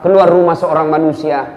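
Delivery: ordinary talk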